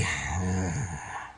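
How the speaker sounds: long audible exhale; deep sigh. Acted. Patient breathing out deeply for the nurse, long sigh. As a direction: let the breath out heavily. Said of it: "take a deep breath for me".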